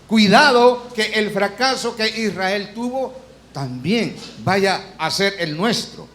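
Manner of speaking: normal talking voice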